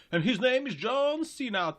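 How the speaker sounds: hammed-up wrestling intro call; mock ring announcer. Parody of wrestling entrance.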